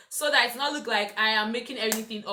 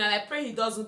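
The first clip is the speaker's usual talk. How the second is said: usual speaking voice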